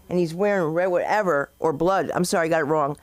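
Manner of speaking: ordinary talk, nothing marked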